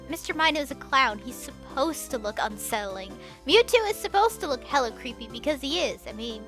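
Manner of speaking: ordinary talk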